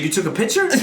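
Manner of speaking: high, amused disbelief; can't believe he took a picture; incredulous retort. Retold or imitated. Retelling a coworker's water story, asking in amused high disbelief.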